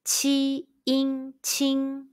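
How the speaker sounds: "Qi, in, qing" each high and level; first tone qing. Shown as a demonstration. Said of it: "high-pitched first tone all together".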